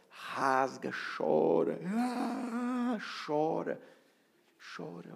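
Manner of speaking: high, drawn-out exhortation with a wail; urging to weep. Shown as a demonstration. Preacher urging congregation to weep, wailing exhortation. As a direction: urge them to cry it all out. Urges crying, demonstrating a wail.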